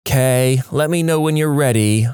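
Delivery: imitating a put-upon, snippy tone; eye-rolling attitude. Shown as a demonstration. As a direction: say it with an audible eye roll. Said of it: "eye roll".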